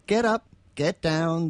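normal talking voice

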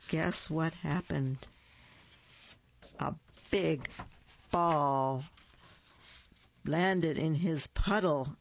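ordinary delivery, nothing marked

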